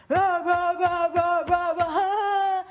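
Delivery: high, loud mocking chant of a rowdy housemate; mock rowdy taunting. Retelling how Kyle barged into the room, mocking taunt. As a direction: ham up a rowdy housemate barging in, taunting, last one drawn out slow. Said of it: "Kyle walks in".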